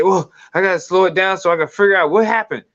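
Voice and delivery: high, quick flustered viewer; mock flustered. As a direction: act out scrambling to keep up. Acting a viewer overwhelmed by speed.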